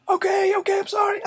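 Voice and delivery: hammed-up bully shrieking promises to his captor; terrified pleading. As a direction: squeal for mercy in panic. Bully keeps begging.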